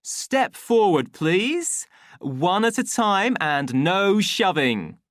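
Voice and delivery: high, loud, slow orders; commanding. A narrator's reading. Official marshalling twins for their tablets, commanding. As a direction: marshal a crowd with firm, measured calls. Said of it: "he commanded".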